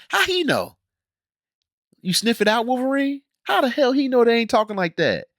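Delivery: high, disbelieving rant at the show; how could he possibly know, said way up high; incredulous mockery. Real, not acted. Scoffing at the show's writers, high and incredulous.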